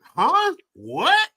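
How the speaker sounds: confused and incredulous, the pitch climbing up to a squeak; baffled, rising to a high squeak. Retold or imitated. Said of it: "I'm just like".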